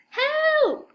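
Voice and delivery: high, loud cry for help; frightened child shouting help; yelled. A narrator's reading. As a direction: scream for help, scared. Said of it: "yelled Kipper".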